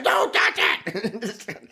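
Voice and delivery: imitated high-pitched banshee shriek; banshee scream. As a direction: screech it slowly, mimicking a screaming child. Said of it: "I mean, like a banshee".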